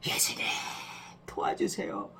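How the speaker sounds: soft whispered call for help; barely voiced whisper; whispered plea. Shown as a demonstration. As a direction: whisper it as if afraid to be heard. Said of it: "그런데 "다윗의 자손 예수님." 이렇게 부른".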